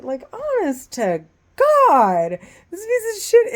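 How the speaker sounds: high, loud rage that peaks on shit; furious outburst. Real, not acted. Woman raging at the man who harasses her, furious and loud. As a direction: explode at him.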